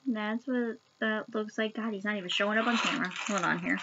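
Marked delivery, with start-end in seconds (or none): none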